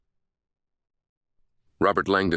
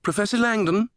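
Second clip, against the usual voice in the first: high British call, rising to a question; much higher sing-song British voice; sing-song British woman. A narrator's reading. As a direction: hail him high in a British lilt. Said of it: "a sing-song British voice shouted".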